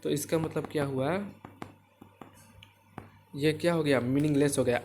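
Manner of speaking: ordinary talk, nothing marked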